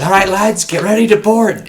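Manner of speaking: high, loud captain's call; captain rallying his crew to board, loud and hearty. Pretend.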